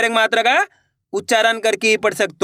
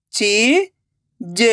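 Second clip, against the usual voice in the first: very slow, each syllable apart; slow syllable reading; much slower than usual. Shown as a demonstration. Teacher sounding out a word for pupils, very slowly. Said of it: "तो बताइए मिलके क्या बनेगा?".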